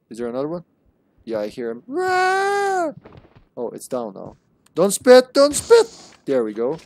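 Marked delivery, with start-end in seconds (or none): 1.9-2.9 long, high-pitched "rah" roar; piercing play-attack yell; high battle screech. Pretend. Battle noise at game enemy. 4.7-5.9 high, loud squawk; loud, high-pitched panicked repeat; panicked yell. Real, not acted. Panicky yell at spitting spider.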